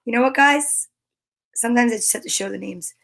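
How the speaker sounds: usual speaking voice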